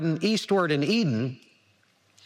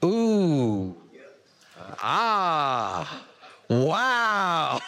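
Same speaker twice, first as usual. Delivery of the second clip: awed wonder; 3 semitones lower, much slower than usual. Pretend. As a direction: gasp at the marvel of creation. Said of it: "You would have went".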